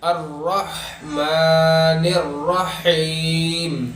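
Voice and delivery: very slow recitation, throaty ح in both words of الرحمن الرحیم; big ha recitation. Shown as a demonstration. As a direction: hold the vowels long in الرحمن الرحیم. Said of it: "دو ہاؤں کو بڑی ہا کی طرح پڑھ لینا".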